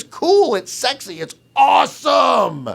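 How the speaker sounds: mock the sales pitch with a fake cheer; mocking hype. Host sneering at the hype, in a sarcastic shout. Mocking how the film is sold.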